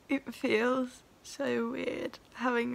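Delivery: ordinary delivery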